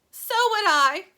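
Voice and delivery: put-on high, loud old woman's cry. A narrator's reading. Grandma Georgina backing up Josephine, high loud cry. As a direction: cry out in agreement. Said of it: "cried Grandma Georgina".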